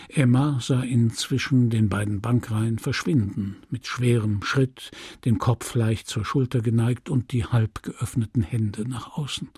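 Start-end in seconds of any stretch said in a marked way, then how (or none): none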